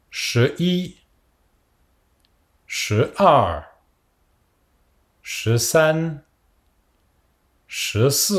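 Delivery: "Che i", "Che san", "Che wu" as drawn-out, toned Mandarin with long pauses; model for repetition. Shown as a demonstration. Teacher counting eleven onward for students to repeat, careful toned "Che i" to "Che jiu". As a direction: give each "Che" number slowly and separately, tones exaggerated. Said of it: "répétez après moi".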